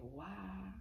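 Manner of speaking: slow, breathy near-whisper; hushed shock. Real, not acted. Remembering her world ending, hushed and stunned. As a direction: sink into a stunned hush.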